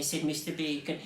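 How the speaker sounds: usual speaking voice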